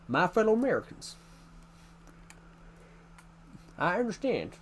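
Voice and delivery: high-pitched American presidential impression; George Bush. Streamer showing off an old presidential impression, American accent. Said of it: "I used to do George Bush quite well".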